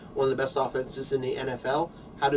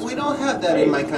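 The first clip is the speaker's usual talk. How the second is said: put-on louder, thick Russian accent; loud comic Russian accent; 5 semitones higher, 6 dB louder than usual. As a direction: insist in broken Russian-accented English. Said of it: "this Russian accent".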